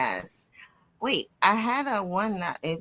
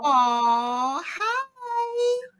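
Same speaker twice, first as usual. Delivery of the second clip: drawn-out aw rising to high hi; cooing greeting; 9 semitones higher than usual. Real, not acted. Host greeting co-host's dog, cooing. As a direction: coo hello to a beloved pet.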